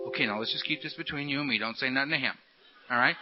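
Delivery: low, soft voice of a conspiring tempter; confiding beast. Acted. The beast conspiring out of the sponsor's hearing, low and soft. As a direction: keep it secret, low and under the breath. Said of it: "And beast whispering in my ear".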